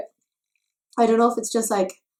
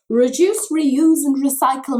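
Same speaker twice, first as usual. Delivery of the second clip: higher, louder chirpy slogan; mock slogan; 6 semitones higher, 7 dB louder than usual. To her friends about a reused dropper, hammed-up slogan. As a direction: sell it like a jingle.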